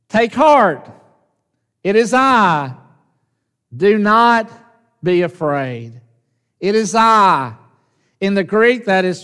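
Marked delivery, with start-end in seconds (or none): 0.1-7.5 called out, louder and higher; Jesus calling out to the frightened disciples. Retold or imitated. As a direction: call across the storm to reassure. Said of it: "Jesus calls out to them quickly".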